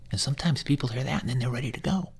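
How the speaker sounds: ordinary talk, nothing marked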